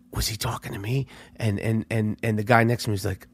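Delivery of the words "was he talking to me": soft, stunned inner question; hushed disbelief, asked to yourself; stunned thought. Retold or imitated. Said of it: "And I'm like".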